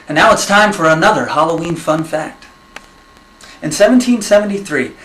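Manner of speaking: ordinary talk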